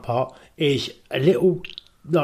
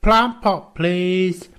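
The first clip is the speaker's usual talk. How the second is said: higher, slowed voice giving orders; mysterious caller voice; 6 semitones higher, 3 dB louder, slower than usual. Retold or imitated. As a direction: deliver it like a secret agent's instructions. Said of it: "he says".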